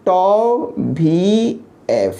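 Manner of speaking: very slow, drawn-out tau vee ef; said lovingly. Shown as a demonstration. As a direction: stretch "tau वी एफ़" out fondly, each sound long. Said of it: "प्यार से बोलते हैं". ("tau वी एफ़" प्यार से, बहुत धीरे खींचकर)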